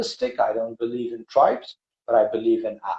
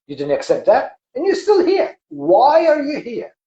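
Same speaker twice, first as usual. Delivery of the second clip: climbs to a high, loud demand; God demanding why the people are still here; thundering God; 8 semitones higher, 4 dB louder than usual. Acted. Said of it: "the God's voice comes out very aggressively, like a thunder".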